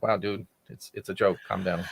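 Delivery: soft, low, deflating retort; calming reply. Retold or imitated. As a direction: talk him down softly, pitched low. His calm reply to the yeller.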